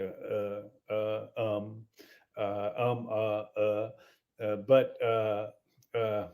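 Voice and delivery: low, soft, endless uhs and ums; mocking a painfully hesitant phone call; halting bad-news caller. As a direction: stall and stammer before breaking the news. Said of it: "this is how this guy talks".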